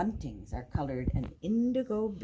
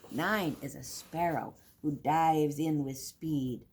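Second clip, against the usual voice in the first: plain reading voice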